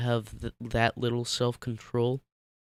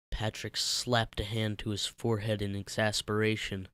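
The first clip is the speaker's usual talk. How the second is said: ordinary delivery, nothing marked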